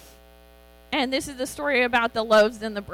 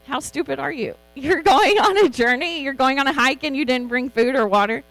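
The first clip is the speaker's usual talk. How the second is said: exasperated mock scolding; 4 semitones higher, 8 dB louder than usual. Real, not acted. Mocks the crowd for bringing no food.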